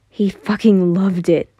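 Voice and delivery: ordinary delivery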